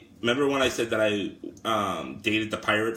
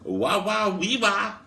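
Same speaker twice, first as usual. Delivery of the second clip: high-pitched comic foreigner catchphrase; excited Borat-style voice; 9 semitones higher, slower than usual. Acted. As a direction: exclaim it high as a wowed Borat. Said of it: "my foreign language one is just Borat".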